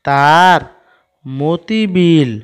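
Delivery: very slow word-by-word reading; reading without spelling. Shown as a demonstration. Teacher reads the line to pupils without spelling, slow and drawn out. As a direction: stretch each word out for early readers. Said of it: "এবার আমরা বানান ছাড়াই পড়ার চেষ্টা করি".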